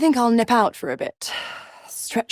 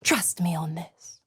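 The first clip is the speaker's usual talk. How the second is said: hushed, whispered aside; confiding whisper; 2 semitones lower, 5 dB quieter than usual. Acted. Devil confiding in her pet about Karlach, whispered. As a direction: drop to a soft whisper.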